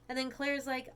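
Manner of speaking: normal talking voice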